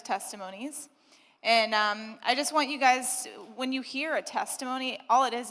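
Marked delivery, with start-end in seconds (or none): none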